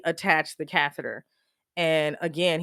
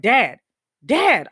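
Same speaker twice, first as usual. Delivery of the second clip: mild mock scream, louder on each call; retold scream; 2 semitones higher, 5 dB louder than usual. Daughter calling her unresponsive dad, retold scream. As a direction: reenact calling out in alarm, without a full scream. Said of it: "I'm, like, screaming".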